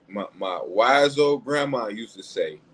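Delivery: ordinary talk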